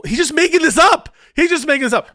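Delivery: high, loud burst of disbelief; real incredulous yell; exasperated outcry. Real, not acted. Exasperated at the clip's argument.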